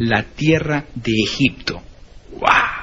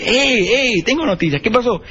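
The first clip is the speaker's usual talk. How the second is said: excited news; 8 semitones higher than usual. Acted. Missionary runs with good news.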